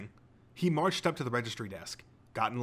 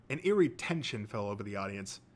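usual speaking voice

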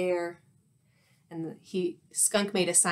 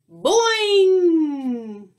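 long, high, loud boing sliding down; imitated bouncing spring, drawn out; big cartoon boing; springy boing; 7 semitones higher, 9 dB louder than usual. Narrator voices the bouncing fish.

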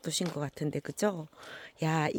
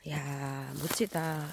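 normal talking voice

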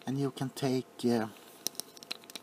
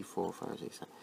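ordinary delivery

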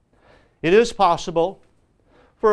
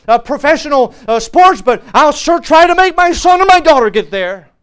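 loud, higher-pitched pulpit preaching; impassioned preaching; 7 semitones higher, 9 dB louder than usual. Real, not acted. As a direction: preach it loud, building to a ringing finish.

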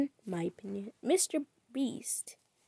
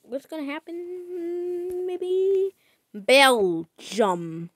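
drawn-out suspense; 7 semitones higher, 7 dB louder, much slower than usual. Real, not acted. Stretched out anticipation.

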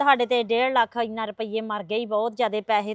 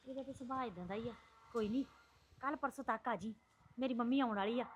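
hushed coaxing; 11 dB quieter than usual. Acted. Aunt quietly sweet-talking the boy, much softer. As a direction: keep it down so the husband won't hear.

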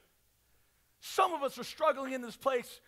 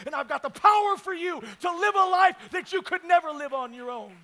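raised, ringing sermon voice; rousing preaching climax; 7 semitones higher, 13 dB louder than usual. Real, not acted. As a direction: swell up on the promise. Sermon climax after soft promises.

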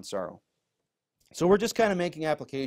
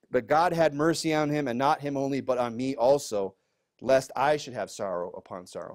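raised, louder Bible-reading voice; scripture reading; 4 dB louder than usual. Acted. Pastor reading scripture to the congregation, raised voice. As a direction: read the verse out with weight to the room. Reads verse twenty-seven.

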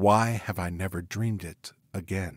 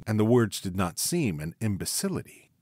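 usual speaking voice

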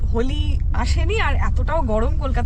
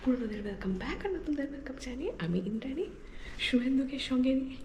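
softer, hushed early-morning talk; 14 dB quieter than usual. Real, not acted. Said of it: "এত আস্তে আস্তে কেন কথা বলছি?".

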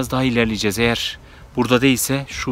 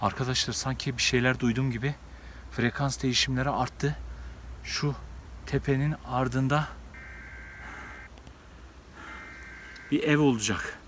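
hushed; 10 dB quieter than usual. Real, not acted. Sensing the creature nearby.

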